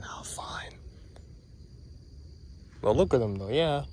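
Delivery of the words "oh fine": whisper. Real, not acted.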